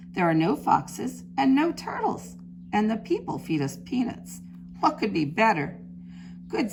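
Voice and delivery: lively picture-book storytelling; reading to children. A narrator's reading. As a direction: read it like storytime for little ones. Teacher reads picture book aloud.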